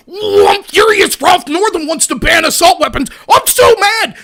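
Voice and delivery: hammed-up outraged shouting; loud, high mock tantrum; mock fury. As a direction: imitate people throwing a furious fit. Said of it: "would get upset and say".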